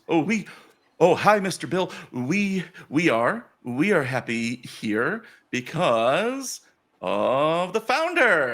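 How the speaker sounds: slow, grand build to a flourish; grandiose priestess. Acted. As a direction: announce it with drawn-out ceremony.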